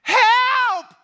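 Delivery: high, loud cry for help; panicked shout from under the bench; screaming for help. Retold or imitated. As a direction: yell it out, trapped under the bar. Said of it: "I start screaming for help".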